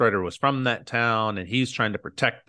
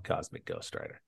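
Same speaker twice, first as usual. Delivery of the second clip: hushed mock reveal; 7 semitones lower, 15 dB quieter than usual. Shown as a demonstration. Hushed grand reveal to cohost.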